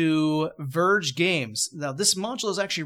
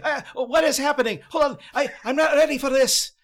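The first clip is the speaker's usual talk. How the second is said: high, loud flustered protest; protesting Scrooge; 11 semitones higher, 7 dB louder than usual. Acted. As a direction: panicky old man objecting. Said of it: "Scrooge starts protesting".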